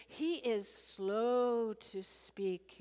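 very slow, stretched "slow to speak"; drawn-out slowness. Shown as a demonstration. Drawing out words to show slowness.

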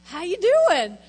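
bright loud "How you doing?"; friendly greeting. Retold or imitated. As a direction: call it out cheerfully. Greeting the cashier.

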